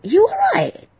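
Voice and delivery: high, punchy mock butcher asking if she's ok; like he'd bust someone's ass for her; butcher's tough concern. Butcher checking on her, a high mock tough-guy concern. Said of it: "He goes to me".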